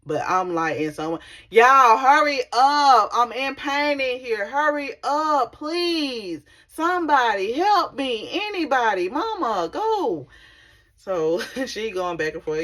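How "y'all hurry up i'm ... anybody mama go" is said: loud, higher and drawn-out yelling; retold plea hollered down the hall; yelling for help. Patient in pain yelling for staff to hurry, loud and high. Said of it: "a person to be yelling out".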